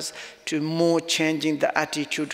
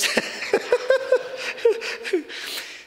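1 tearful laugh. Real, not acted. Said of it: "he's also crying".

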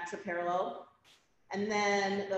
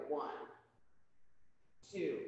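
usual speaking voice

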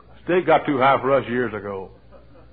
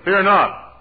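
raised, louder preacher's call; proclaimed promise; 7 semitones higher, 6 dB louder than usual. Acted.

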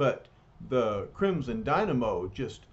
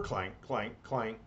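tank clanking; 4 semitones lower, 7 dB quieter than usual. Acted. Imitates the tank's tracks.

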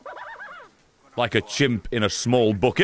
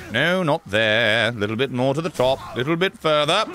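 ordinary talk

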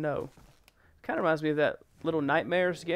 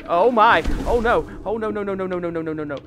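loud startled panic, words tumbling; panicked; 3 semitones higher, 9 dB louder, faster than usual. Real, not acted. Player to self as the game goes wrong, panicked. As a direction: blurt a rapid, alarmed string of cries.

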